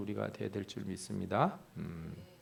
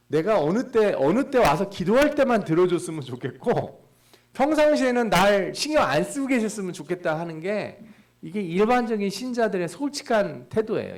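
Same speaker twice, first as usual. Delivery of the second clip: 높고 크게 외치는 설교; 회중을 향해 힘껏 외치듯; full-force preaching; 8 semitones higher, 9 dB louder than usual. Acted. (목사가 신자들의 태도를 꾸짖듯 크게 설교하며)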